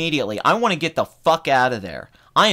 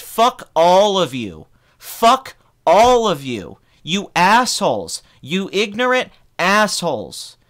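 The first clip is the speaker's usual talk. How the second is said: slow, emphatic retold shout; loud, clear cursing; 3 semitones higher, 4 dB louder, slower than usual. Angry moviegoer curses out the clapping theater, slow emphatic yell. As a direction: spell out the insult word by word, loud and drawn out. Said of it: "very clearly and as loudly as I could".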